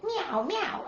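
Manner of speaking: a little kitty meow; cat meowing. Acted. Storyteller meows for the cat to the kids. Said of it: "the cat went".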